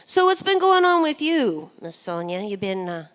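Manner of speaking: ordinary delivery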